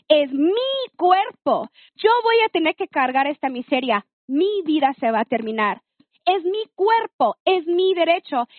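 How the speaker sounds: defiant lioness let out, without raising the volume; retold fury. Woman confronting boyfriend over her body, retold indignant anger. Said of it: "salió la leona y me dio un coraje".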